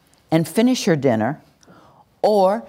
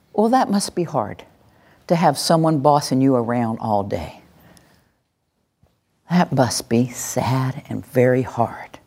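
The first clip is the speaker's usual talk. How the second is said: empathetic reflection; 5 semitones lower than usual. Shown as a demonstration. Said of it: "Take a moment, breathe, add that pause".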